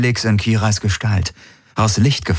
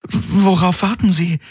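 stammering, much higher, lost and confused; utterly bewildered; 11 semitones higher than usual. Acted. Kirai, baffled by the enemy holding fire, asks in bewilderment. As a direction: stumble over the first word in total confusion. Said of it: "Kirai klang hoffnungslos verwirrt".

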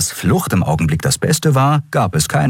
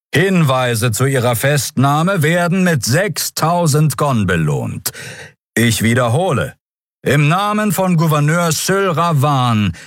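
public announcement; 6 semitones higher than usual. Acted. Herald announcing a bounty to the crowd, proclaiming. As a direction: read it out like a herald over the crowd.

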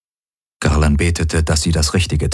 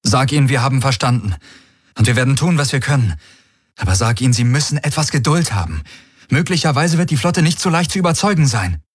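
higher-pitched, pressing reply; urgent negotiator; 7 semitones higher, faster than usual. A narrator's reading. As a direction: plead for patience in a raised voice. Galen replies through the translator.

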